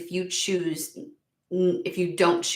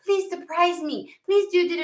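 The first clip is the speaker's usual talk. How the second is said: mock eager; 8 semitones higher than usual. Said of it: "who ... is like".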